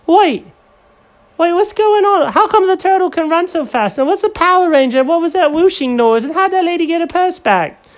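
very high, loud rapid questions; high baffled Jenkins; squeaky Jenkins. Acted.

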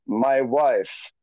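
low, subdued farewell message; sad, retold. Dead man's video to his wife, mock sad and low. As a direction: imitate a sad recorded goodbye, pitched low. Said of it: "but it's, like, sad".

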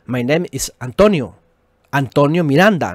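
"My name is Antonio" with Antonio kept in plain Spanish sounds; right way, keep Antonio. Shown as a demonstration. Preacher showing the correct self-introduction, plain Spanish-accented English. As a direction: keep "Antonio Miranda" Spanish, lead-in in his own light English. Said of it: "Yo tengo que decir allá".

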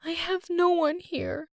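high tearful voice, slowing down; crying. A narrator's reading. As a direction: miss him through tears. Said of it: "She was looking at a picture and crying".

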